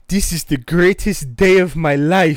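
movie impression, hammed-up African accent; imitated African accent. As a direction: gush like an overjoyed African fan, accent thick. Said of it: "when them African dudes see Eddie Murphy".

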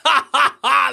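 loud high belly laugh; triumphant laugh. Acted. Dwarf laughing at the beaten halfling, loud and high. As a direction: roar with triumph.

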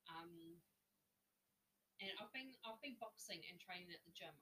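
usual speaking voice

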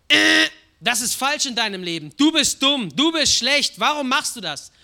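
loud harsh scolding; loud mocking rebuke; scolding God. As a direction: yell it like a berating father.

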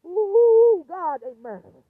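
a sudden loud wail of outrage; a real anguished shout to God; outraged cry. Real, not acted. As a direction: cry it out at full volume. Angry rant about police.

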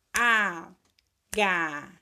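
"Agasalho" as a, ga, sa, lho, each held long; long gaps between stretched syllables. Shown as a demonstration. Teacher having pupils count syllables, slowly separating them. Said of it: "Separou direitinho?".

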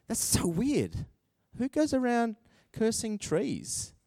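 soft, slow puzzled aside; bemused musing. Retold or imitated. Reader puzzling over the fig tree, soft and slow. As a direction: wonder to yourself quietly.

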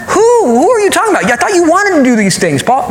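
high-pitched, baffled challenge; incredulous questioning. Acted. Preacher plays a listener quizzing Paul, incredulous. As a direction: question him in a high, disbelieving voice.

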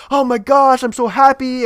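high, loud joyful outburst; euphoric. Retold or imitated. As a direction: burst out overjoyed. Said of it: "euphoric moment".

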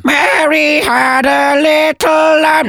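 very high, drawn-out parrot screech; parrot reciting. Acted. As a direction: screech the rhyme like a parrot, slowly. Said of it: "So a law-breaking parrot there".

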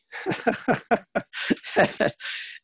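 high, wheezy run of laughter; laughing. Real, not acted. Speaker amused at calling himself a real baby, laughing. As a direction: laugh helplessly at yourself.

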